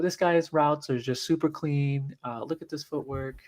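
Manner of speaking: smooth slowed-down breakdown voice; Clean Mike voice. Shown as a demonstration. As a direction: talk it up like a neat, careful film-room analyst. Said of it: "he talks like this".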